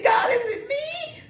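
high, crying plea with a shout; tearful cry. Retold or imitated. Woman retelling her tearful question to God, crying. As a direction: cry out to God through tears.

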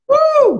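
high joyful preacher whoop; loud, high celebratory woo; excited whoop. Real, not acted. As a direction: let out a sudden high woo of joy.

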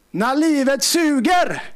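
drawn-out preacher's cry; preaching refrain. Acted. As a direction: proclaim it to the church. Preacher's refrain, rising intensity.

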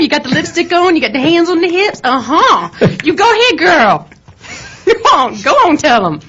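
put-on high sassy girl-talk encouragement; sassy cheering. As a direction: hype him up, sassy. Egging him on, sassy.

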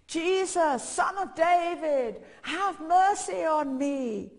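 loud, high, drawn-out call; shouting out. Retold or imitated. Blind beggar calls to Jesus, shouting out. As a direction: call out slowly for mercy over a crowd. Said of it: "he was shouting out".

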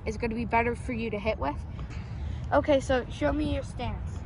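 loud coach on the field; football coaching. Real, not acted. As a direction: bark instructions like a coach. Brother coaching football loudly.

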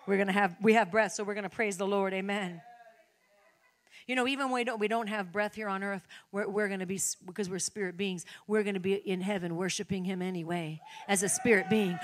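usual speaking voice

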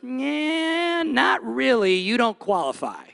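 mocking little voice in the head; doubting inner voice. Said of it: "this little voice goes".

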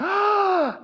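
loud, high intake of shock; big sudden gasp of amazement; sharp gasp. Retold or imitated. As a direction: imitate a woman's astonished gasp. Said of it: "and she went".

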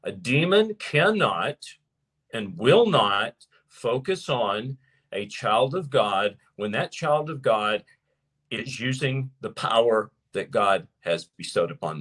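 each phrase spaced out slowly, leaning on demon; slow down and drive every word home like a preacher; measured, weighty restatement for the congregation; slow deliberate emphasis. Shown as a demonstration. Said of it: "Let me say that again".

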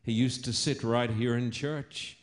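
usual speaking voice

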